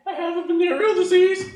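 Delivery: put-on high-pitched teenage confession; squeaky mock confession; acted confession. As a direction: blurt it out high.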